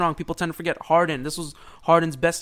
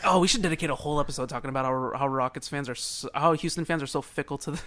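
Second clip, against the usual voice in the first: usual speaking voice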